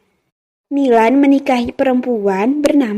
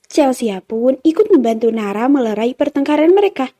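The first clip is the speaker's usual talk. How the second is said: usual speaking voice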